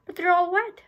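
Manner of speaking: mock sob. A narrator's reading. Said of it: "I sobbed".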